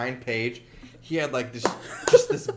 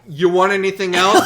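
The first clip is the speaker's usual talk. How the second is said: loud, higher, mock-irritated question; impatient questioning; 5 semitones higher, 11 dB louder than usual. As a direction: demand it with hammed-up impatience. Acted impatient counter worker.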